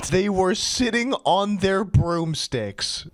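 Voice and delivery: high, slow, breaking into giggles; real laughter. Real, not acted. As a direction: read through helpless laughing. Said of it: "that was a drink for laughing".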